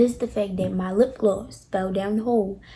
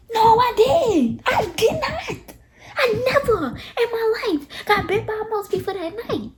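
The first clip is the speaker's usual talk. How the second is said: much higher, half-crying, half-laughing protest; laughing wail; 11 semitones higher than usual. Retold or imitated. Protesting to the friend that she never got bit, much higher laughing wail.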